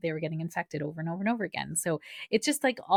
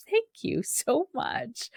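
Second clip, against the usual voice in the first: a hint of the young nurse, higher and slow; moved nurse; 8 semitones higher, much slower than usual. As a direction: lightly voice him, choked up and slow. Nurse moved, thanking mother.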